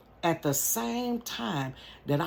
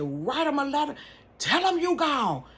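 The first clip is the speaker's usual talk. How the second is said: commanding aunt; 11 semitones higher than usual. Retold or imitated.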